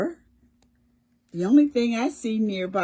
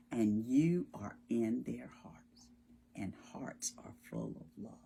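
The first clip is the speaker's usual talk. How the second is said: whisper; 7 semitones lower, 10 dB quieter than usual. Real, not acted. Tender close to children.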